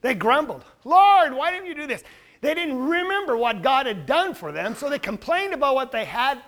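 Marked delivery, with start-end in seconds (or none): 0.8-2.0 mock grumbling complaint. Voicing grumbling Israel.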